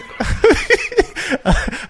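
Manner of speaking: high bursting laugh at the memory; loud laugh. Real, not acted. Laughing while retelling the scam to a friend.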